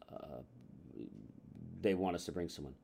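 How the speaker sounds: low, soft, hesitant reply; reluctant customer. Acted. Customer pressed about bringing a date, quietly reluctant.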